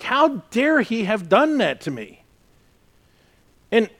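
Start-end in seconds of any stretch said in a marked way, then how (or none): 0.0-2.2 indignant. Retold or imitated. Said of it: "I'm like".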